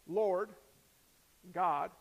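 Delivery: each word set apart by a long pause; separate, deliberate words; slowed down. Shown as a demonstration. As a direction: weigh LORD and GOD one at a time. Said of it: "if you slow down and you take those two words".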